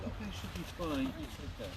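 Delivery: ordinary talk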